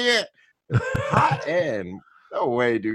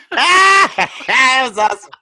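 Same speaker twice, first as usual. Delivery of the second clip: much higher and louder, slow and drawn out; thrilled; 9 semitones higher, 6 dB louder, much slower than usual. Real, not acted. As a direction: stretch it out in sheer delight. Delighted reaction after the clip.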